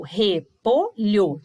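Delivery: "repolho" devagar em RE, PO, LHO; separação de sílabas. Shown as a demonstration. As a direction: dite "repolho" sílaba por sílaba. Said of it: "fazer a separação das sílabas". ("repolho" com pausa entre as três sílabas)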